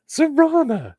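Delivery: high, drawn-out catchphrase call. Shown as a demonstration. Says the catchphrase a viewer waits to hear, sung out high. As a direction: sing out the signature word high. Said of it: "I only watch to hear you say".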